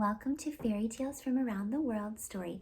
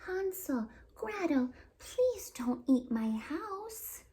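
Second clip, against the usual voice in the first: high, slow, begging witch voice; pleading good witch; 9 semitones higher, much slower than usual. Acted. Willow begging the children not to eat her house, high and slow. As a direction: plead sweetly with naughty children.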